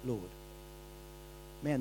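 ordinary talk

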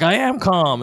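loud, high-pitched mock protest; yelling. Husband told to calm down by his wife, yelling back. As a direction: yell it while claiming to be calm. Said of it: "I'm yelling".

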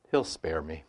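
ordinary talk

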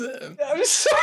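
slow, high apology through laughter; crying laughing. Real, not acted. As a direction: squeeze it out while laughing.

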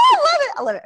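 high and loud delight; delighted squeal. Real, not acted. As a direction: squeal with joy. Gleeful over the name.

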